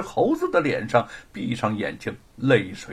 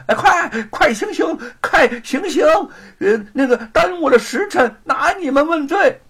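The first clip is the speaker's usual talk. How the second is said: high, loud frantic yelling; loud shout; 11 semitones higher, 5 dB louder than usual. A narrator's reading. Lackey runs up yelling at the executioners, frantic shout. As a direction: bark urgent orders at the executioners. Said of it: "跑过来大声地喊".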